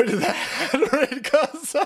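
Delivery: words dissolving into high laughter; can't get the words out for laughing. Real, not acted. Bursts out laughing.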